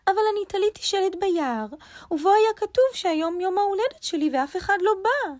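high-pitched rabbit, hurt and indignant; a bit angry, a bit sad. A narrator's reading. Rabbit complains nobody came to his birthday, a bit angry and sad. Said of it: "אמר ארנב בקול קצת כועס וקצת עצוב".

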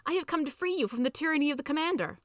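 high, declamatory rescuer's speech; theatrical liberator's announcement; heroic proclamation. A narrator's reading. Savior proclaims liberation to handmaids.

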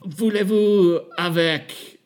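slow, laughing gibberish French; fake French accent. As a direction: drawl it out in bad fake French. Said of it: "fun little accent".

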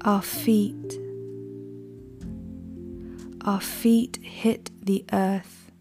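slow, hushed ritual chant; incantation. Acted. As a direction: intone it like an ancient invocation. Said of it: "the poem itself is read almost like an incantation".

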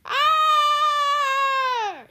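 very high, long loud scream. A narrator's reading. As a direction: scream at full pitch in terror. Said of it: "Sears screamed".

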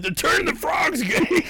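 high shouted conspiracy rant; ranting Alex Jones. Retold or imitated. Host imitating a radio ranter, high frantic shout. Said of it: "He's like".

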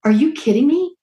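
high, incredulous Are you kidding me; retold disbelief. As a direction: jump up in pitch in mock disbelief. Retelling shock at husband.

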